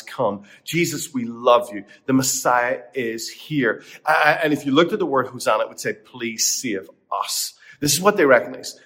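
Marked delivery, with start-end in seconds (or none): 2.0-3.8 slow, weighty declaration; awed, drawn-out announcement; slow proclamation. Acted. Proclaiming the Messiah slowly. 5.9-7.5 slow plea. Retold or imitated. The crowd's plea voiced.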